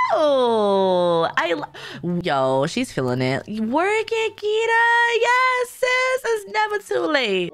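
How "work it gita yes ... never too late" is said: high-pitched hype-up for a client; hyped cheering. Real, not acted. Hyping up the client for photo.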